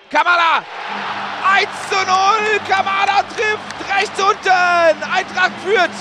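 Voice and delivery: yelling the goal call, high; screaming goal commentary. Real, not acted. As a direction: shout the goal with full joy.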